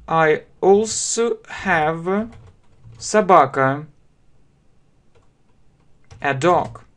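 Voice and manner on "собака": normal talking voice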